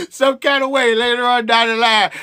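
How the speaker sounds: laughing through the words; real laughter breaking in mid-sentence. Real, not acted. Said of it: "And I'm laughing".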